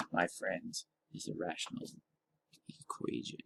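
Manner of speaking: whispered reveal, dropping to a hush; dramatic whisper. Shown as a demonstration. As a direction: lean in and whisper it. Teacher builds to the term.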